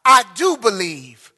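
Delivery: loud, slow cry of faith; a desperate father calling out, drawn out. Retold or imitated. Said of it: "the Father instantly cried out".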